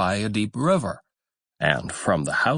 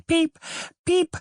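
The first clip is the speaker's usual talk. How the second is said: very high tiny peeping; chick peeps. A narrator's reading. Newly hatched ducklings crying out, high tiny peeps. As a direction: squeak it like newborn ducklings. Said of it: "its head and cried".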